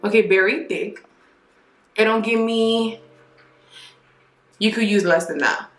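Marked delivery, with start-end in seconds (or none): none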